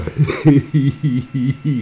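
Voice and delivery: loud, high real laugh; loud laughing outburst; burst of laughter. Real, not acted. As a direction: let a big laugh out. Laughs at comment.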